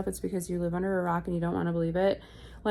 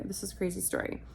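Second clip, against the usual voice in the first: ordinary talk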